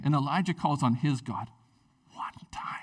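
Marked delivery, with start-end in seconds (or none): 2.1-2.7 breathy whisper for effect; whispered emphasis. Shown as a demonstration. Preacher stressing Elijah prayed once, whispered. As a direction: hush it to make the point land.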